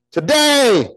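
high, loud, drawn-out exclamation; emphatic preaching cry. Real, not acted. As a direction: ring the word out to the room. Preacher calls out scripture's word.

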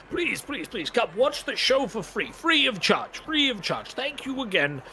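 high, fawning showman's voice; a high, fawning Doctor Malthus; grateful showman. Acted. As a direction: gush thanks like an oily showman. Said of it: "Malthus again".

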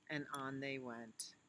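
plain reading voice

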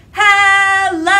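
loud high sung hello. Shown as a demonstration. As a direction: belt the hello out as loud as you can. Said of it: "as loud as we can".

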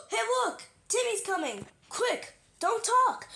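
high, urgent pretend warning; high-pitched playful alarm. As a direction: warn the others quickly in a high toy voice. Toys warn that Timmy is coming.

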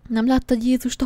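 usual speaking voice

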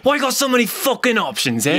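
hammed-up frustrated action star; annoyed Jason Statham. Statham frustrated at the drive-thru menu, mock-annoyed. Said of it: "And he's like".

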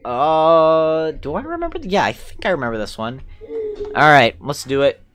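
usual speaking voice

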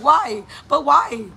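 whining questioning daughter. Retold or imitated. Imitating her daughter questioning her, loud childish challenge. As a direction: pester like a kid challenging an order. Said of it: "my daughter would be like".

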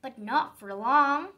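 ordinary delivery

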